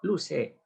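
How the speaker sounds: "Luce" with final i turned to e; Quechua-Spanish "Luce" for Lucy; "Luce" ending on e; Andean vowel confusion. Shown as a demonstration. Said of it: "Si eres Lucy".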